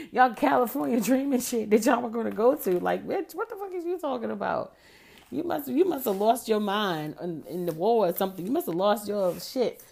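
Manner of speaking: loud, emphatic scolding; retold rant, punching out the words; indignant telling-off. Telling him off in the car, indignant.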